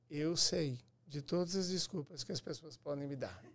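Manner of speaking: ordinary talk, nothing marked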